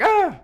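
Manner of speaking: high, loud yelp mimicking a voice crack; mock your own cracked shout; short squeaky "ah" in self-mockery; imitated voice crack. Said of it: "I just heard my own voice there".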